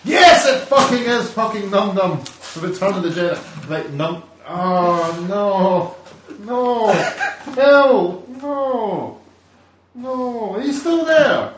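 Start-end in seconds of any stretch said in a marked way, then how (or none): 0.0-2.2 loud, high exasperated yell; real exasperated shout. Real, not acted. Opens dreaded toy, exasperated. 4.5-11.5 high, loud despairing wail; loud dismayed wail. Real, not acted. Despairing at the toy.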